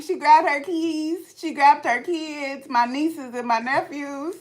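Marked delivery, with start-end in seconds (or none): none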